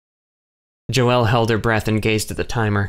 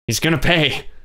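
an outburst of revenge; rising anger; 6 semitones higher than usual. A narrator's reading. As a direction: let the fury break out. Kay repeats the vow.

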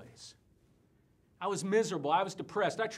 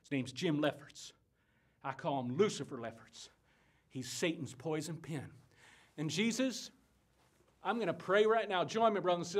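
ordinary delivery

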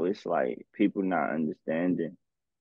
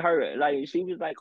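ordinary delivery

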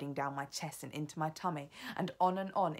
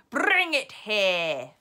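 loud, slow, drawn-out witch command; 4 semitones higher, 12 dB louder, much slower than usual. Acted. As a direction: draw out the order menacingly. Grand High Witch ordering witches.

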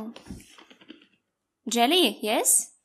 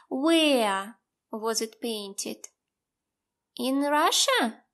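put-on reading to a toddler; 2 semitones lower, slower than usual. Teacher quizzes small child.

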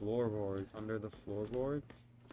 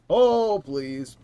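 frightened plea; 8 semitones higher, 17 dB louder than usual. Real, not acted. Monster appears.

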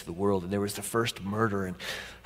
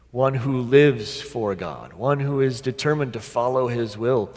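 raised, emphatic pulpit voice; impassioned preaching; 4 semitones higher, 7 dB louder than usual. Real, not acted. Preacher describes one who lives for God, impassioned preaching. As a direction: drive each phrase home, climbing in pitch.

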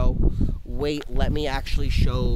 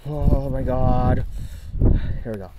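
ordinary talk

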